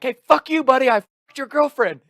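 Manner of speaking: high, loud mock shout; taunting yell. Guy taunting a rival at the bar, retold taunting yell.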